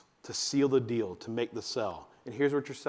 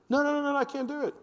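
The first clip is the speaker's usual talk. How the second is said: very high mock-scared child; put-on childish fear; panicky kid; 13 semitones higher, faster than usual. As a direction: squeal a kid's refusal. Acting the scared kid refusing.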